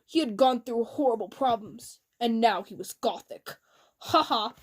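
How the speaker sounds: ordinary delivery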